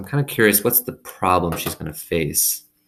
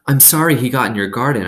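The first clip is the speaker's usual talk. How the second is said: hurried apology; 6 semitones higher, 5 dB louder, faster than usual. A narrator's reading.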